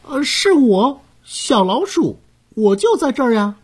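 high squeaky mouse voice; small critter answering brightly; little mouse voice. A narrator's reading. (小老鼠的尖细声音)

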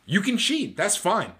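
high, sing-song mock permission; Rob Manfred. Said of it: "says Rob Manfred".

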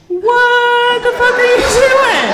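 high, drawn-out mock outrage; incredulous high-pitched imitation of a man; imagined doctor's outburst. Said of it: "that's what he looked like he said".

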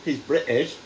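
mock-British accent, proudly shown off. Shown as a demonstration. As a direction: try out a British accent on two words. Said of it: "That was a great accent".

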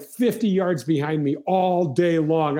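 ordinary talk, nothing marked

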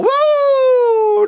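long, very high "woo"; loud imitation of kids cheering; celebratory whoop. Kids win lunch from dad, imitated excited whoop. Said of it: "They're excited. They're celebrating".

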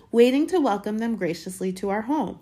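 lightly voiced proud aunt rehearsing her part; Aunt Petunia, eager. A narrator's reading. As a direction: put on a hostess lilt.

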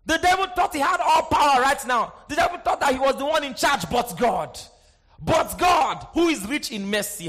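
high, loud preaching; preaching at full force. Acted. Preacher declaring God's power to the church. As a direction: let it ring out.

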